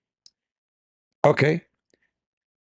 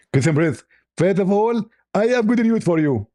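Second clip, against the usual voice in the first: higher, squeezed preacher voice; Zakir Naik impression; 5 semitones higher than usual. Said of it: "I am Doctor Zakir Naik".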